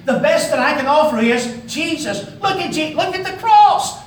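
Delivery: high, loud, slowed "look at the cross"; impassioned cry. Real, not acted. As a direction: lift the voice and call them to the cross. Preacher's climax, pointing to cross.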